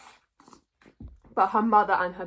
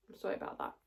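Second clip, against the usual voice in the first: whispered aside; 12 dB quieter, much faster than usual. Real, not acted. Apology for messy notes, muttered aside.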